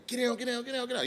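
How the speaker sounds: high, barked mock cop orders; retelling the feds yelling at him; mock police commands. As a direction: imitate agents ordering him down. Imitating feds raiding the house.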